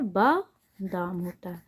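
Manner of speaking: बादाम drawn out slowly, word by word; slow emphatic correction. Shown as a demonstration. Teacher to students, repeating the right word slowly and clearly. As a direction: spell out बादाम slowly for the class. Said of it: "क्या होता है?".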